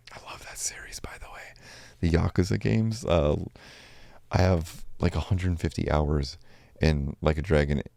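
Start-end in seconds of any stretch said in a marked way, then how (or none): 0.1-1.4 whispered aside. Real, not acted. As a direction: whisper it like a secret. Quiet aside about a favorite game.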